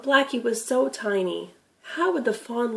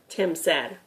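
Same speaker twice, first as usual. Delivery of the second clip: plain reading voice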